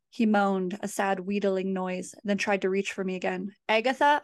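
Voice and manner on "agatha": louder, higher call down the hall. A narrator's reading. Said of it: "I called, my voice echoing".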